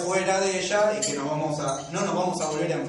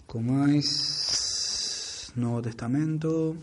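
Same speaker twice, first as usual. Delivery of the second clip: drawn-out words, low and slow; slow self-dictation; 4 semitones lower, 4 dB quieter, much slower than usual. Real, not acted. Teacher typing a Bible search, slowly sounding it out. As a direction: stretch each word while typing it.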